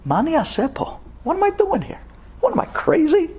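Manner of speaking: high, bewildered muttering to himself; inner voice, exasperated. Retold or imitated. Homesick Israeli asking himself why he's here, high and baffled. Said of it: "he started thinking to himself".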